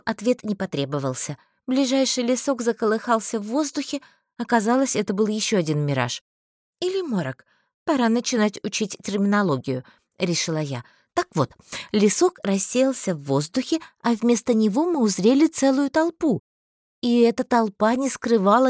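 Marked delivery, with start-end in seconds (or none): none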